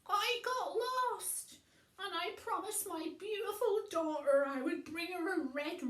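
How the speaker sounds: high, loud, panicky pleading; frightened old man. Acted. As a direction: rush out the excuse in fear.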